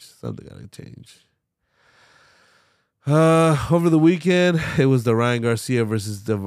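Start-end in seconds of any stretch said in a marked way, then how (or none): 0.0-1.2 muttered. Real, not acted. Frustrated about his diet.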